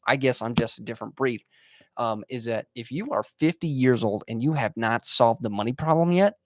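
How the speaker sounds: normal talking voice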